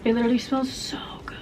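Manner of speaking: breathy whisper. Real, not acted. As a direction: whisper it softly. Whispering over fresh sheets.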